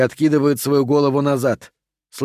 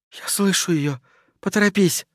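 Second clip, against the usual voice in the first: high, hushed urgency; frightened warning; 5 semitones higher than usual. A narrator's reading. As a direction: warn in a high, small voice. Кристи слышит приближение Агаты. (испуганное тихое предупреждение)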